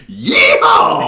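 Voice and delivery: high, loud mock cowboy yell; cowboy whoop. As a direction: whoop like a caricature American cowboy. Mocking cowboy cry after American impression.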